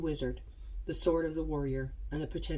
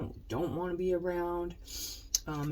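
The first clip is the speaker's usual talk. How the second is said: normal talking voice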